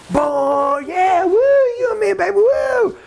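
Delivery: very high, shouted sing-along whoops; party along like a carried-away fan; whooping fan. Acted. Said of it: "you're out there going".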